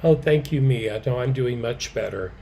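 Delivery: normal talking voice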